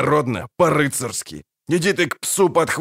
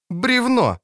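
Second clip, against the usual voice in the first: warning cry; 11 semitones higher than usual. Acted. Ciri warns of log.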